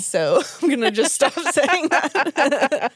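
slow words broken up by laughter; laughing admission. Real, not acted. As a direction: give up on it while giggling. Laughing at own broken promise.

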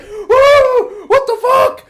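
very high, loud hysterical yelling; mad screaming. Retold or imitated. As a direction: imitate a raging player screaming orders. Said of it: "he got so mad that he left".

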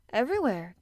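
high, loud gasped answer; breathless little exclamation. A narrator's reading. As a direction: gasp it out eagerly. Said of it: "gasped Mary".

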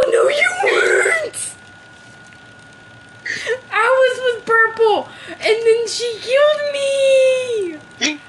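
very high, drawn-out protest; shrieked, stretched-out complaint; indignant wailing. Real, not acted. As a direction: wail it like a wronged victim. Arguing over being killed in game.

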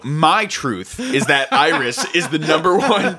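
laughing. Pretend. Mock "my truth" claim, laughing.

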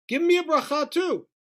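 high, childish mock whine; pouting kid. Esav wanting a blessing too, like a pouting kid. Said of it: "he's like a kid pouting".

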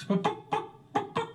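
high, squeaky chicken clucking. Acted. Chicken voice in joke.

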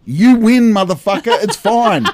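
loud, high, fed-up surrender; exasperated outburst. Retold or imitated. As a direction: throw your hands up and shout it.

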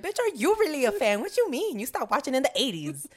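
high, loud, sassy comeback; a squealing put-down, hammed up; mock outraged retort. Retorting to a fake fan, high, loud and sassy. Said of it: "And I'm just like".

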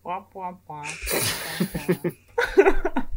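wah-wah like a muted trombone; Charlie Brown teacher. Retold or imitated. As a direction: drone it like a muffled horn. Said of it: "And the teacher's like".

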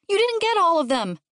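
put-on high-pitched kid's complaint; whiny child voice; upset child. A narrator's reading. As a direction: accuse Mom like a distressed kid. Upset child over tadpoles.

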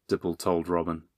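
usual speaking voice

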